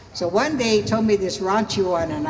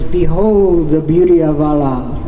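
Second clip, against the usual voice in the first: lower, loud mock-grand proclamation; 4 semitones lower, 10 dB louder than usual. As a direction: proclaim it low and loud, as a punchline. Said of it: "and he goes".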